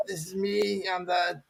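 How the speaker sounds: ordinary talk, nothing marked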